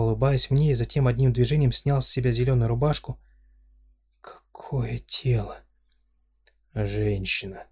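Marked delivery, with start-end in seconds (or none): 4.2-5.6 breathless whispered awe; admiring whisper. Acted. Heroine dazzled by body. 6.7-7.6 a lower, softer stirring call. Acted. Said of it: "низким волнующим голосом".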